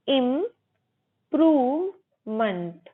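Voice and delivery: 'इम्प्रूवमेंट' slowly as im, proov, munt; syllable by syllable. Shown as a demonstration. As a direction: break 'इम्प्रूवमेंट' into held syllables, ending in a weak 'munt'. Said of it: "इम्प्रूवमेंट नहीं, मंट".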